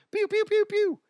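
very high pew pew blasts; toy gun noises. Retold or imitated. Imitating kids' toy guns.

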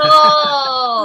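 loud, drawn-out "oh" of delight; long, loud, real cooing reaction. Real, not acted. Host reacts to guest's DM love story, delighted.

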